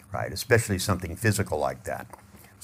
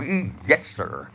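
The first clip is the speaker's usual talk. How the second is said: eager yes sir; slower than usual. Shown as a demonstration. Eager worker.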